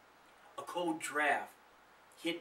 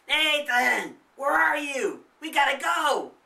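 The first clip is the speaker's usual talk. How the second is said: higher, much louder shout; hollering; 11 semitones higher, 11 dB louder than usual. A narrator's reading. Charlie hollering for Nathan in the dark, loud. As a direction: yell for him through the house. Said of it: "Charlie hollered louder".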